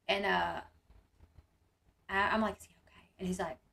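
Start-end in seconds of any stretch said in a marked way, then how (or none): 2.6-3.1 a breathy, unvoiced whisper across the sidelines. Mother whispering to the coach from the stands, hushed. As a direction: barely voice it so only the coach can read it.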